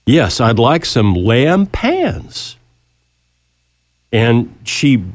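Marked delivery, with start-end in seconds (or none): 0.1-2.6 slow, drawn-out Louisiana drawl, repeated patiently; heavy Louisiana accent. Retold or imitated. Howard repeats his order to the puzzled waitress in a Louisiana accent. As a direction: slowly say it again in a thick Louisiana accent. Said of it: "heavily accented way of speech".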